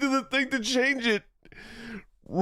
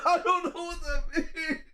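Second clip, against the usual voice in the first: high, laughing and softer; giggling, a touch softer; 6 semitones higher, 3 dB quieter than usual. Real, not acted. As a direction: let the laugh break through the words.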